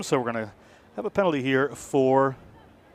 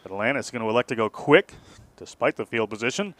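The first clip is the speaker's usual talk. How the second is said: ordinary delivery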